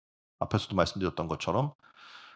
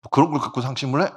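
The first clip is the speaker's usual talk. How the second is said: annoyed friend; 3 semitones higher, 4 dB louder than usual. Acted. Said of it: "이 친구 화가 났어요".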